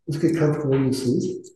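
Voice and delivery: guided meditation. Acted. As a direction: ease the group gently into stillness.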